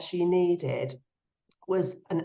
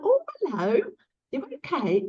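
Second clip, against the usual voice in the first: tender greeting; 7 semitones higher than usual. Retold or imitated. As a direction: greet her gently, lifting high. Greeting mum as she wakes.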